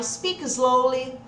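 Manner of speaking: very slow, drawn-out stock phrase; devagar, tipo papagaio. Shown as a demonstration. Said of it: "tipo papagaio". (bem devagar, decorado)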